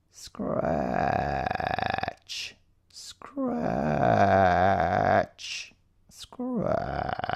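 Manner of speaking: hushed, drawn-out creepy sound words; a spooky whispered scratch, scratch; eerie soft scratching. A narrator's reading. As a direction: breathe them out like something in the dark. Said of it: "soft and spine-tingling in the darkness".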